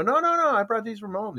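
high, innocent no no no; insisting with wide eyes; fisherman. Retold or imitated. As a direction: fisherman swearing they are pets. Fisherman voiced in joke.

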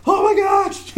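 very high, squeaky gasp of amazement; mock overwhelmed. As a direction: replay being too giddy to think. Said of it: "I wasn't thinking clearly".

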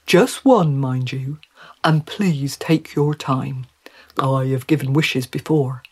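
gently cautioning fish; advising talking trout. Acted. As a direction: counsel her as a wise, courteous trout.

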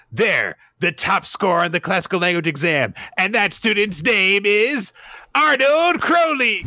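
put-on little girl proudly announcing the winner; triumphant announcement. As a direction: announce it like a big reveal.

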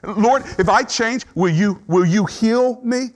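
high, halting, urgent plea; bargaining. Acted. Preacher acting out a mourner bargaining with the Lord, pleading. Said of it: "Bargaining is when you say".

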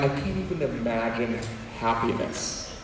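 low, hushed gloom; imitating someone sunk in sadness; mock sad. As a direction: sink down soft and low, as if hopeless. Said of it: "been sad and you're like".

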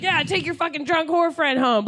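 high-pitched mock jeer; harassing guy. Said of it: "some guy that was standing next to us in line is now harassing my friends".

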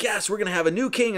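high-pitched imitated cheer; mock celebrating. Pellinor celebrating the new king, mock jubilant. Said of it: "he's, like, celebrating".

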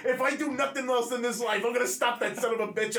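loud, high fired-up vow; determined shout. Retold or imitated. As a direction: yell it like a sworn oath. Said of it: "I was like".

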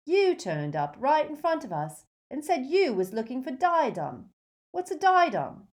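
high, dim-witted character voice; gormless henchman. A narrator's reading. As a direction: gormless grin in the voice, rising in pitch. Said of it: "his voice split into a gormless grin".

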